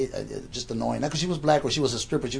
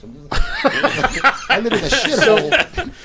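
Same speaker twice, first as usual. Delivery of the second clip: laughing; 11 semitones higher, 9 dB louder than usual. Real, not acted. Laughing at himself.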